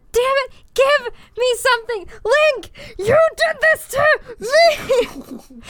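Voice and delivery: high, loud, dragged-out shouted blame; hammed-up labour yell. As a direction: blame your husband mid-contraction, over the top. Hamming up the labour scream.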